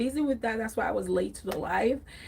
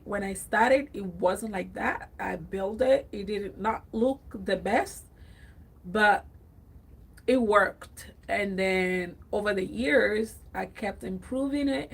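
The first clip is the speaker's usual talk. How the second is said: ordinary talk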